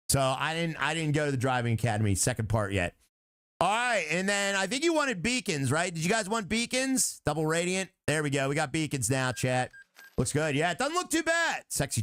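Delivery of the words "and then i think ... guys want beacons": ordinary talk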